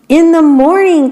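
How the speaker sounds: high, loud group cry; excited cry. A narrator's reading. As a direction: cry out together with joy. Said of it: "cried all the other beetles".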